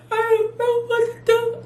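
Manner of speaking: high, breaking cry of despair; distraught wail. Retold or imitated. Calling her husband, fired, high distraught wail. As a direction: wail it like you're falling apart.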